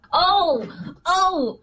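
sudden high exclamation; surprised. Real, not acted. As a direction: gasp it out as it clicks. Sudden realization of song.

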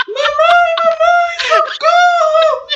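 very high wailing cry for mom; crying child screaming. Acted. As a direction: sob and shriek like a panicked little girl. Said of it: "E chorando".

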